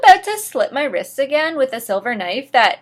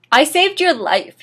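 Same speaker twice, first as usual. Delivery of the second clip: angry yell; 5 semitones higher, 5 dB louder than usual. Acted. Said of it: "he yelled angrily".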